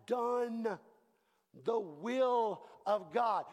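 drawn-out "So that after you have done the will of God"; slow scripture reading. Shown as a demonstration. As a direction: read it slowly, word by word. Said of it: "But listen to this".

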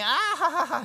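very high, loud mock laughter; squealed put-on laugh; imitated child laugh. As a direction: laugh like an unbothered little kid. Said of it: "She's, like, laughing".

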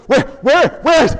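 panicked parent calling; hurried frantic call; frantic searching. Retold or imitated. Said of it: "running around looking for Cameron".

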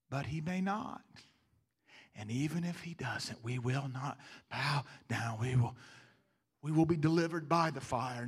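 soft, low, steady conviction; quiet resolve. Acted. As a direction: say it calmly even in the face of death. Calm reply facing the furnace.

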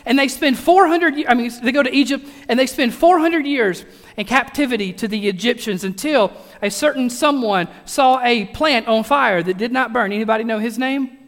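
normal talking voice

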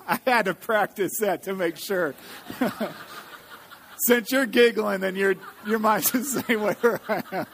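talking through giggles; laughing. Real, not acted. Reader admitting to the audience she practiced, laughing. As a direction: crack up while trying to explain.

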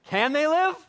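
high, loud, slow pleading; a loud slow plea, voice lifted high; pleading cry. Retold or imitated. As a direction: cry out to God in disbelief. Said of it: "Ezekiel rightfully is saying".

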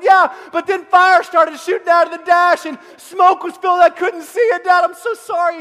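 loud, high, frantic mock pleading; panicked excuse. Son explaining the crash to dad, mock-panicked loud pleading. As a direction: blurt out a hammed-up, panicky apology.

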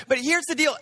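usual speaking voice

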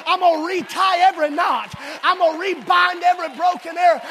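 loud, high resolute vow; determined vow. Acted. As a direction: vow it like a fierce mother.

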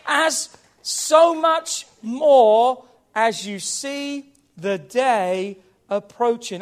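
very slow, weighty preaching; slow, hammered Bible phrase; emphatic scripture quoting. Acted. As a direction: draw out every word, starting loud. Preacher quotes Hebrews with weight.

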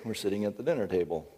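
usual speaking voice

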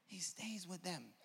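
soft, slow, savoring delivery; quiet, drawn-out statement; hushed repeat. Real, not acted. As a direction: bring it right down and let it sink in. Same answer repeated softly after exclaiming.

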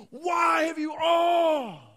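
very high, loud anguished mock cry; exaggerated lament shout; hyperbolic cry. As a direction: wail it out like overdramatic David. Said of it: "David would often say these things like".

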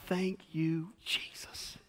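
breathy whispered prayer of thanks; relieved whisper. Retold or imitated. Pastor sees his friend Andy step out, whispering relief. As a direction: whisper your thanks as the trooper turns out to be a friend. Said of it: "And I'm like".

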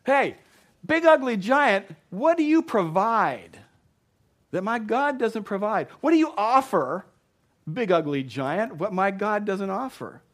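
loud, high-pitched defiant shout; taunting challenge. Acted. David challenges the big ugly giant, taunting shout. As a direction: shout down a towering enemy with defiance. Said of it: "but he says".

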